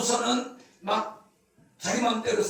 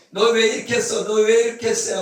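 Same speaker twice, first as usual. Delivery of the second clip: loud, accusing nag; scolding; 3 semitones higher, 8 dB louder than usual. Acted. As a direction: snap the question like a nitpicking critic. Preacher acts out a scolding critic.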